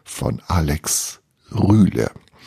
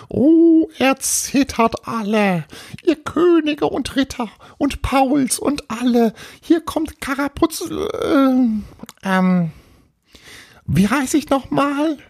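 very high squeaky proclamation, faltering at the end; boastful little ghost. Acted. Little ghost threatens kings and knights, high grandiose voice. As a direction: proclaim like a tiny would-be terror, then lose the thread.

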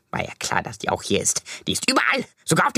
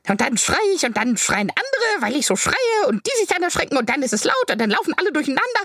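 fast panicky rambling; 6 semitones higher, faster than usual. Acted. Panicked rambling about chaos.

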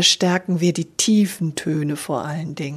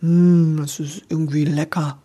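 lips pushed forward, dark rounded resonance; a relishing "Mm", dark and round; 2 semitones lower than usual. Shown as a demonstration. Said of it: "wenn ich die Lippen so nach vorne mache".

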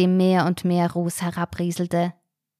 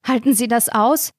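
gasping, higher and louder; out of breath from the fight; panting question; 5 semitones higher, 5 dB louder than usual. A narrator's reading. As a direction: Karina gasping at Ronny, breathless. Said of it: "keuchte Karina".

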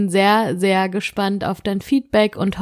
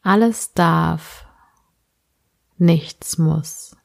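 sleep meditation; slower than usual. Acted. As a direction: guide the listener gently into sleep.

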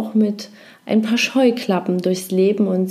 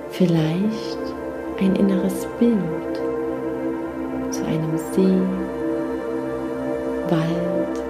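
guided meditation; 3 dB quieter than usual. Acted. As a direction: lull them gently into rest.

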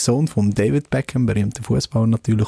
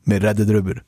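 ordinary talk

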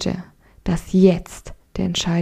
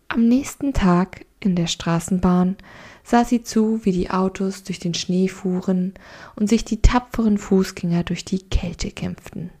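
ordinary delivery, nothing marked

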